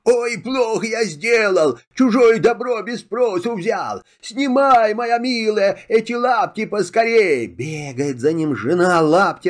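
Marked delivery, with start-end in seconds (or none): 0.0-4.0 high, distressed outcry while spinning; Ой and сделал cried out high in distress; cried out in dismay. Acted. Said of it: "вертится да кричит". 4.2-7.5 high, urgent plea to his wife; pleading shout. Acted. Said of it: "кричит".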